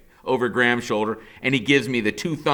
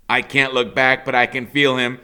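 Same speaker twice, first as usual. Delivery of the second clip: usual speaking voice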